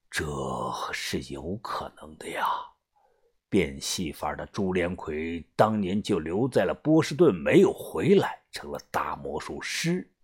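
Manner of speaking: hushed, low elder voice; pondering gang boss. Acted. Boss weighing an unsettling theory, hushed and low. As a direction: let it sink in, low and soft.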